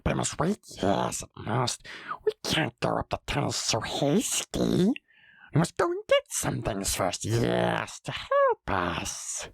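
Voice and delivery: slither the words like a cave creature; wheedling Gollum with gulping gollums. Acted. Gollum stalls.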